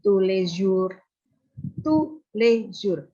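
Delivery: ordinary delivery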